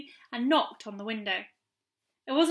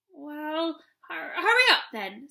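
nervous; slower than usual. A narrator's reading. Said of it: "said Chinky nervously".

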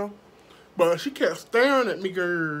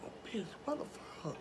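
fast, alarmed whisper; 15 dB quieter, much faster than usual. Real, not acted. Whispering in shock about the gun. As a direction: hiss it under your breath.

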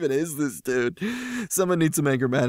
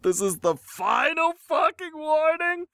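mock angry warning; 13 semitones higher than usual. Reader quoting the poster's threat back, mocking squeal. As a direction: parody a huge ultimatum. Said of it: "or that he doesn't want to see you".

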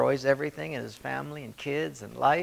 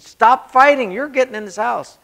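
much higher, much louder shout; dad at the end of his patience, yelling; furious yell; 11 semitones higher, 13 dB louder than usual. Retold or imitated. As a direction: blow up at the kids. Third warning, yelling.

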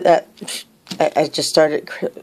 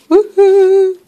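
high, louder woohoo; excited cheer; 12 semitones higher, 6 dB louder than usual. Real, not acted. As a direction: let out a high whoop of excitement. Cheering at the gift.

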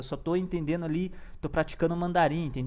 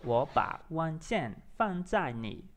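very slow Mandarin, broken into chunks; careful repetition; 3 dB quieter, much slower than usual. Shown as a demonstration. Learner echoing the app's answer, slow and careful. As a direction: draw out each syllable, repeating the ending. Repeats model sentence in practice.

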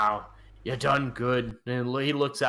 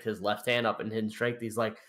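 ordinary talk

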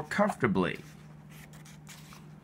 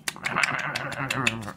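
a short warm reader's laugh; light laugh; 3 semitones higher than usual. Acted. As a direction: laugh at the big number. Larvae eating a hundred aphids.

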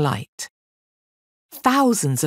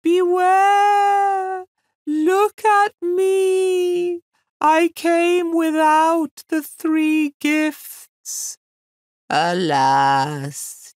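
much higher, loud slow wail; wailing ghosts; 8 semitones higher, 5 dB louder, much slower than usual. Acted. Ghosts warning the maiden, loud high wail. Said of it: "she heard them wailing".

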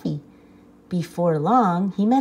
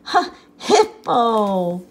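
laugh-gasp the name out; gasping laughter; 3 semitones higher than usual. Acted. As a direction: rhino finally wheezing out his name. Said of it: "gasped the rhinoceros".

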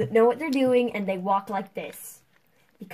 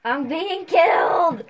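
high, loud, drawn-out wail; alarmed yell; 8 semitones higher, 10 dB louder, slower than usual. Real, not acted. Hider getting killed in the game, loud high yell. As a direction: yell slowly and high, in a panic over the game. Player cries out as being killed.

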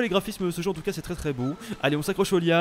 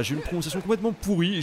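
normal talking voice

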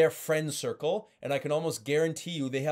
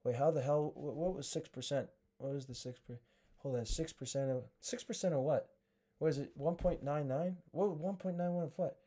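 confused inner thoughts; 8 dB quieter than usual. Retold or imitated. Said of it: "now all they can think of is".

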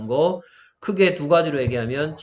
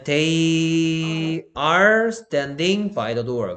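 very slow and a bit louder English example; slow model sentence; 3 semitones higher, 5 dB louder, much slower than usual. Shown as a demonstration. Teacher reading a model sentence aloud to students, slowly. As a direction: read the example slowly for the class.

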